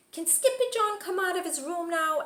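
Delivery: put-on high child voice asking; little sister. Little sister asking mother in a high child voice. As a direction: ask sweetly like a small kitten.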